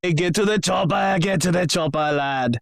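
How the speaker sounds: high-pitched mock-Austrian action hero accent; hammed-up Austrian movie catchphrase; Schwarzenegger. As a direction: do an awkward Austrian strongman accent. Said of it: "sound like a very awkward Schwarzenegger".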